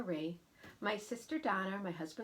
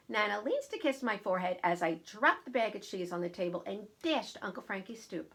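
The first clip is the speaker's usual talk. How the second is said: plain reading voice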